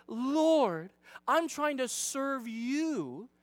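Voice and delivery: mock pleading, stretched words; pulling on heartstrings. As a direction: drag it out like a hammed-up martyr. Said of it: "she's pulling on Jesus' heartstrings".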